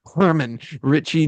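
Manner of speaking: ordinary talk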